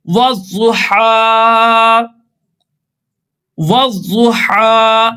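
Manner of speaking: वज़ुहा with the last हा held one alif long; stretched final vowel. Shown as a demonstration. Teacher shows students how to lengthen हा in वज़ुहा. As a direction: draw out हा in वज़ुहा, slowly. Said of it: "इसको एक अलिफ की मकदार खींचेंगे".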